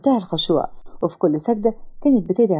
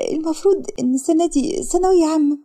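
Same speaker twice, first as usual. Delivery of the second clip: put-on higher girl's voice on the edge of tears; tearful girl; 7 semitones higher than usual. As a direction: hold back tears, barely getting it out. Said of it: "والدموع بدأت تظهر في عينيها". (بنت على وشك البكا بصوت أعلى)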